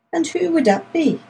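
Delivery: mildly anxious, high-pitched question; a bit anxious. A narrator's reading. Lady asking about the go-between, a bit anxious. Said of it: "asked the Honourable Marjorie a bit anxiously".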